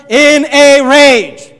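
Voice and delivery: very slow, loud, word by word; emphatic rage. Acted. Preacher stressing Naaman's rage from the verse, booming. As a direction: boom out each word of the phrase. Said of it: "Look at these words".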